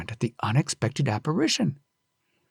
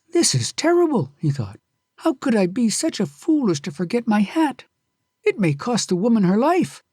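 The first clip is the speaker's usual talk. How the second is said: horrified Kyusuke; 8 semitones higher than usual. Acted. Kyusuke blaming himself for the forgotten hat, horrified. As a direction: reproach yourself in horror.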